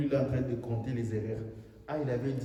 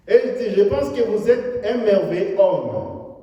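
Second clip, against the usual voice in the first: loud, high fervent sermon delivery; full-force preaching; 8 semitones higher, 12 dB louder than usual. Acted. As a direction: preach it out to the hall. Preacher retells critic scene.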